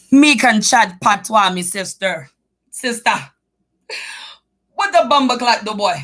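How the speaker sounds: playfully doing Jamaican patois. Pretend. Joking to chat about learning patois, in a mock Jamaican accent. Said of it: "That's more like Jamaican".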